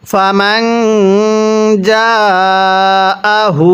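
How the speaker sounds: জাআহু stretched four counts; four-count madd; long madd. Shown as a demonstration. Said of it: "চার আলিফ টেনে পড়তে হয়".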